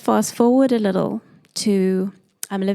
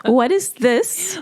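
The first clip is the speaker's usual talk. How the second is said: slow incredulous question; 3 semitones higher, slower than usual. Retold or imitated. Retelling her reaction to the book, slow and incredulous. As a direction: drag it out, stunned and half laughing.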